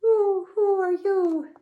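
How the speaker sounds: high, loud hooting owl. A narrator's reading. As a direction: hoot it slowly like an owl. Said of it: "the owl went".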